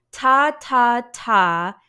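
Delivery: "Ta, ta, ta" with a loud, crisp T; T-sound demo. Shown as a demonstration. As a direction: release each "ta" with a burst of air. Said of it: "between a T sound and a D sound".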